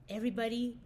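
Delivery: usual speaking voice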